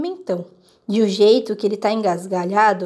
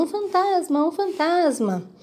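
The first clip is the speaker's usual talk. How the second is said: higher, a hint of terrified passers-by; slowing cry of panic, pitched up; panicked screaming; 7 semitones higher, slower than usual. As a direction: people in the street fleeing a ghost, mild mock scream.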